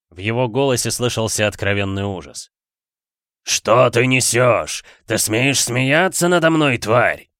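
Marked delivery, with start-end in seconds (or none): 3.4-7.3 loud, slow furious roar; angry demon shout. Acted. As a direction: rage at the servant. Демон гневно кричит на слугу. (гневный рёв демона)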